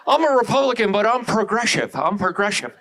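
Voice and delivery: slurred, slushy-s celebrity imitation; Caitlyn Jenner. Caitlyn Jenner declaring her politics, slushy-s impression. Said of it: "you ever hear Caitlyn Jenner talk?".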